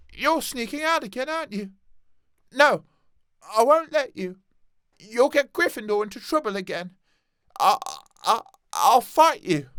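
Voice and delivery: put-on timid boy standing up to friends; nervous Neville.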